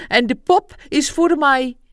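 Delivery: loud, high, insistent voice; solemnly entreating. A narrator's reading. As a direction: state it like a binding vow. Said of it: "zei hij op bezwerende toon".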